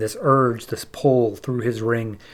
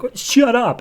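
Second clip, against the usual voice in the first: mock angry outburst; 10 semitones higher, 4 dB louder, slower than usual. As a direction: cut them off, pitched way up. Bleez snapping before attacking.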